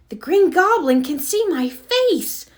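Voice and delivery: high, panicked cry; afraid. A narrator's reading. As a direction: blurt it out scared. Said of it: "Spider-Man was angry and afraid".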